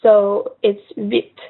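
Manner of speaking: huit said slowly as weet, no H sound; slow weet with the H dropped; silent H. Shown as a demonstration. Teacher showing huit to learners, slow silent H. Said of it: "we don't really pronounce the H".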